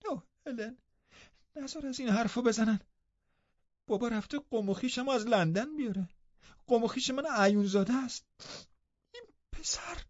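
put-on high, soft, tearful girl; distressed child close to crying; upset. A narrator's reading. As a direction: plead in a small high voice, breaking off. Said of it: "کاتی با ناراحتی گفت".

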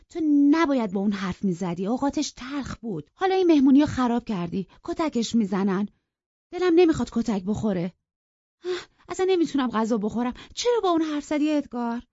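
put-on high, fast, agitated girl's complaint; she's frantic he'll be beaten; upset girl scolding. Cathy reproaches Edgar for provoking Heathcliff, high and rushed.

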